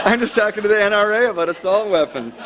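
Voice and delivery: high, put-on phone-call voice; Rudy Giuliani. Politician tells wife about his N R A talk, joking imitation. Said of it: "so tempted to do a Rudy Giuliani".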